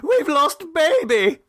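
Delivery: high, slow, panicked mother's cry; a frightened young mother crying out; screaming mother. A narrator's reading. A mother tells Clovis the baby is lost, high panicked cry. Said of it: "she screamed".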